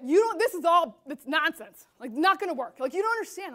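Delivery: loud, exasperated mock complaint; retold angry outburst. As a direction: act out a fed-up employee dismissing it all.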